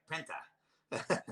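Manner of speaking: "penta" drawn out, ending in an open "ah"; Australian ER ending. Shown as a demonstration. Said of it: "anything that ends in an E R, you make it an A".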